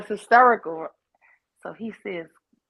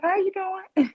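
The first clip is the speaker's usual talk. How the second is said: imitating her young self; 10 semitones higher, slower than usual. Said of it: "I was like".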